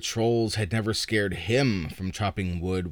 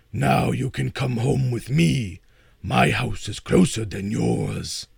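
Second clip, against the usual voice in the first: a louder, lightly voiced troll; 4 dB louder than usual. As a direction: invite him home in the troll voice. Troll invites boy home.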